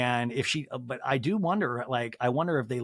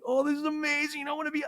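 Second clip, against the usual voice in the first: dreamy; 12 semitones higher, faster than usual. Retold or imitated. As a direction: sigh it out like you're in love. Dreamy first-love memory.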